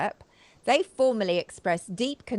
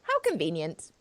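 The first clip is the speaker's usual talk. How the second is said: dry sarcasm; 3 semitones lower, 4 dB quieter than usual. Acted. Sarcastic remark on land change.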